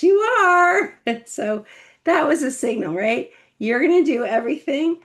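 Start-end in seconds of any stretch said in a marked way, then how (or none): 0.0-0.9 high, loud, no-arguing retort; firm insistence. Retold or imitated. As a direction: shut the protest down. Mom insisting, firm reply.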